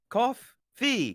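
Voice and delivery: "Cough. Fee." pulled apart, each half said on its own with a stop between; split into syllables. Shown as a demonstration. Streamer splitting coffee into syllables to show how she says it. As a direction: spell out "Cough" then "Fee" as two separate beats. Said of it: "That's how you say cough".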